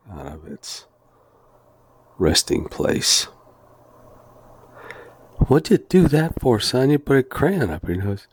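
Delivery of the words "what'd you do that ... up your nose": scolding dad. Retold or imitated. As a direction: play the puzzled dad asking what his son did. Father scolds son in story.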